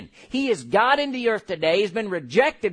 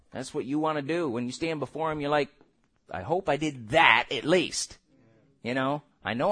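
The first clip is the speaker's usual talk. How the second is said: sincere, lowered voice; 8 semitones lower, 5 dB quieter than usual. Real, not acted.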